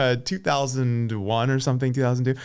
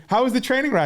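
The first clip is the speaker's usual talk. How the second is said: bright Noel; 11 semitones higher, faster than usual. Retold or imitated. Host Noel asks him cheerfully.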